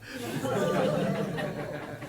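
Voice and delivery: bursts into a high breathy laugh. Real, not acted. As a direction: laughs at the punchline. Laughs at own punchline.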